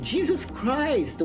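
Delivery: ordinary talk, nothing marked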